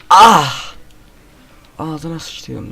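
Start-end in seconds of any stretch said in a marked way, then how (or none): 0.1-0.7 startled cry. Real, not acted.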